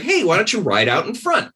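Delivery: high, sweetly coaxing voice; mock-innocent suggestion; coaxing guide. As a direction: sweet-talk him into going first. Guide coaxing monk, voiced quote.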